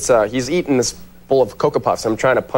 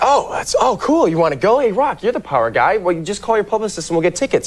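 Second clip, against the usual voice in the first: higher, excited fan voice; eager; 6 semitones higher than usual. Retold or imitated. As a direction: overeager invite to a rock concert. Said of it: "I was like".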